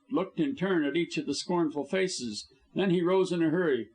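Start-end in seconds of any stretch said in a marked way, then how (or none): none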